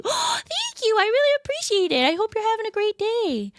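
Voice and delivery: put-on high, bubbly cute girl voice; cute anime girl voice. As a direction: gush thanks in a squeaky cute voice. Thanks viewer in cute voice.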